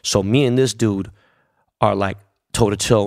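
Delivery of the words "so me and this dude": plain reading voice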